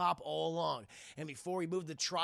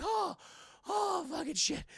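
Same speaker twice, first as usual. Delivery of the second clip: high "oh"s with pauses; mock shaken gasping; 11 semitones higher than usual. Heroes stopping after the wild spin, mock shaken exclamations. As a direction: gasp it out like after a scare. Acted dizzy relief after spinning.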